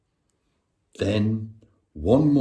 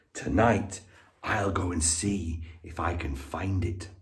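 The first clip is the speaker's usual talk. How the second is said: quiet to himself; 5 semitones lower, 9 dB quieter than usual. A narrator's reading. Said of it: "he said to himself".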